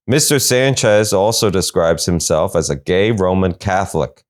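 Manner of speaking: ordinary talk, nothing marked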